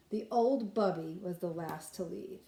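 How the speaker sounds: plain reading voice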